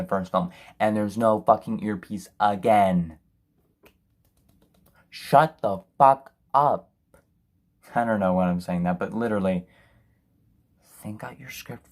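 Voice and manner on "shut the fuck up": slow, louder, fed-up outburst; exasperated yell. Real, not acted. Reviewer snaps at the film's dialogue, slow and loud. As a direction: spit it out slowly at the screen.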